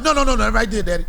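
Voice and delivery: put-on loud, high kid protest; a child frantically stopping daddy; bossy little son. Son stopping dad from helping with the project, loud high. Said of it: "No, wait, don't do it like that".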